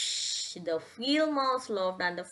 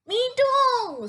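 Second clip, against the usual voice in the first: very high, loud squeak; tiny mouse squeaking in; field mouse; 7 dB louder than usual. Acted. Field mouse joins in, high squeak.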